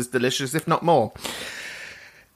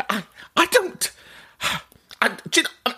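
normal talking voice

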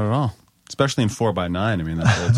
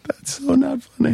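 laughing protest; slower than usual. Real, not acted. Laughing reaction to story.

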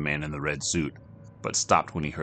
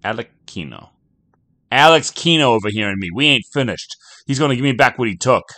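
louder and higher, insistent; defiant; 7 semitones higher, 10 dB louder than usual. Acted. Jack insisting to the bartender, loud defiant. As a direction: stand your ground.

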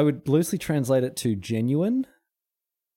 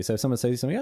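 ordinary delivery

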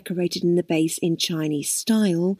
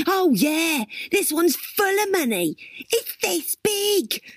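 high-pitched mocking sarcasm; sarcastic; 11 semitones higher, slower than usual. A narrator's reading. Crystal scoffs at Dwayne's piggy bank remark, heavily sarcastic. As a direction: overdo the false agreement, high and drawn out at the end. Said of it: "Crystal said sarcastically".

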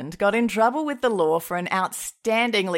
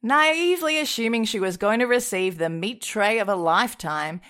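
ordinary talk, nothing marked

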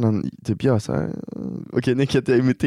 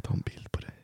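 whisper; 14 dB quieter than usual. Pretend. Mocking bedroom talk.

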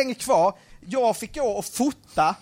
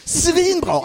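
high-pitched eager hype; excited, raised-pitch reassurance; super pumped; 6 semitones higher, slower than usual. Retold or imitated. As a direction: imitate someone thrilled and all keyed up. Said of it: "Han är superpeppad".

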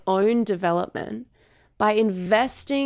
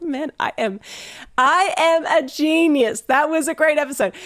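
hammed-up smug; 6 semitones higher than usual. Host mocking her past self, hammed-up smug. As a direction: play it like you think you're a genius.

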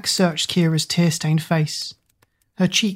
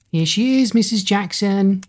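yelling; 4 semitones higher than usual. A narrator's reading. Schoolgirl telling on Kira from the doorway, calling out. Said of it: "Chloe Wilson yelled from the doorway".

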